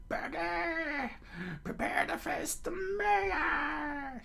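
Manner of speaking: high, drawn-out wailing shout; hammed-up charging mayor yell; battle cry. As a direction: bellow a challenge in a strained high voice. Mayor charges off challenging Buggy.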